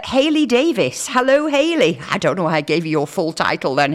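ordinary delivery

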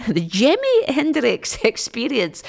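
slipping into a Scottish accent mid-sentence with a laugh; a laughing Scottish lilt on the band name; sudden Scottish accent. Real, not acted. Radio DJ fumbling a band name, in a Scottish accent. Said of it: "I've got no idea why I've got a Scottish accent".

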